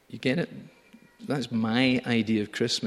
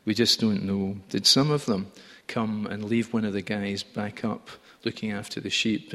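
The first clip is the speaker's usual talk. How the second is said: normal talking voice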